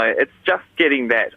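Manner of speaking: normal talking voice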